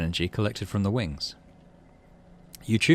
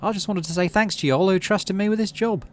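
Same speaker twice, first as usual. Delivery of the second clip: higher, clear voice carrying over a crowd; clear ringing voice; 10 semitones higher than usual. Acted. Utopia thanking the crowd in a clear ringing voice. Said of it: "her clear voice ringing out over the crowd".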